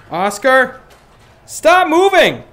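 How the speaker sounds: real frustrated shout at the screen; exasperated yell. Real, not acted. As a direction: snap at the sim to stay put. Yelling at drifting sim.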